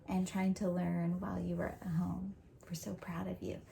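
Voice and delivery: hushed whisper, barely voiced; whispered praise. Real, not acted. Teacher whispering pride to her students.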